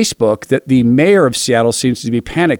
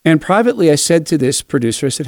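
ordinary delivery

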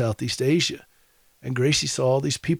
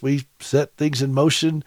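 ordinary talk, nothing marked

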